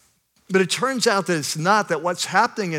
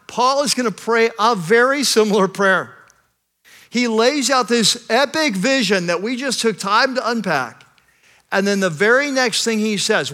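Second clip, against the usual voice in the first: louder, rising sermon build to a climax; preaching at full force; 6 semitones higher, 4 dB louder than usual. Acted. Pastor to his congregation, preaching at full force. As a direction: preach it, lifting the room.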